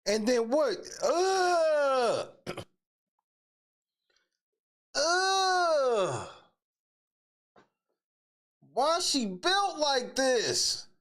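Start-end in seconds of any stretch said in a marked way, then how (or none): 1.0-2.3 disgusted groan. Real, not acted. Disgusted reaction to the picture. 5.0-6.3 disgusted groan. Real, not acted. Disgusted reaction to the picture.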